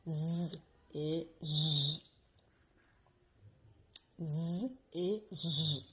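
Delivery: "Z et J" drawn out, Z buzzed, J hushed; slow Z and J. Shown as a demonstration. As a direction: contrast the two sounds slowly. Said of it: "la différence entre les deux consonnes". ("Z et J" lentement, bien distincts)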